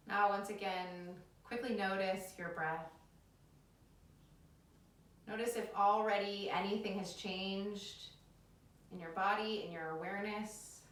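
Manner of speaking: calm them down into the breath; soothing yoga teacher. Acted.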